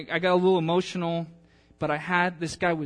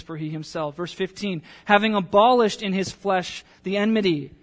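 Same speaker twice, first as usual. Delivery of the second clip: louder, measured Bible reading; scripture reading; 4 semitones higher, 5 dB louder than usual. Acted. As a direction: proclaim the verses to the congregation. Reads scripture aloud.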